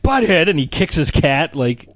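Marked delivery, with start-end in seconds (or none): 0.0-0.5 high loud outraged echo; indignant surprise. Retold or imitated. Boss hearing the insult, loud outraged surprise. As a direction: spit the word back, offended. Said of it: "the guy's like".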